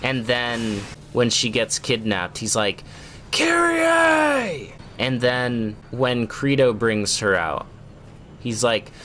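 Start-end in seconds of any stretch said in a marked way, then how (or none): 3.3-4.7 high, drawn-out mock scream of a name; hammed-up dramatic yell, pitched way up; Nero yelling. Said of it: "inexplicably yelling her name".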